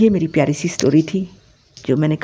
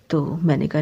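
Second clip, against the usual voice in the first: ordinary talk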